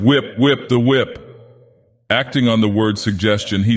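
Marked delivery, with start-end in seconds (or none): 0.0-1.3 crowd crying out. A narrator's reading. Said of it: "heard cries of".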